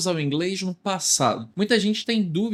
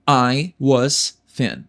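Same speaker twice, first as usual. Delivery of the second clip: I was thin bem lento e articulado; devagar; 3 semitones lower, much slower than usual. Shown as a demonstration. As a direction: cada palavra separada para o aluno repetir. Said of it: "Como eu digo, eu era magro". (I was thin, lento, para repetir)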